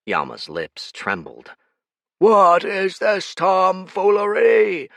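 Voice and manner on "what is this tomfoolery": slow, higher, louder roar. Acted. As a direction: roar in fury at the trick. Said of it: "he roared".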